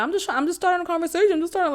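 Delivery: quick, lightly voiced defensive ex; mimicking the ex. Mocking the ex's excuse, lightly voiced. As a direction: rattle it off like a guy caught out. Ex playing it off.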